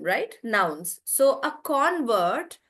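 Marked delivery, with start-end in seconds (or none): 1.4-2.6 CON in "convert" stressed, drawn out; stress on first syllable. Shown as a demonstration. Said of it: "that's how you're pronouncing it".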